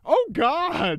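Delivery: high squeaky mock despair; high exaggerated groan. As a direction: wail it way up high. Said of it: "he was like".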